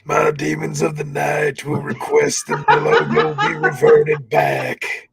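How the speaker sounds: slow, ominous Undertaker threat. Acted. Undertaker demands the old logo back, slow menace. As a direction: stretch the words, menacing. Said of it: "talking about The Undertaker".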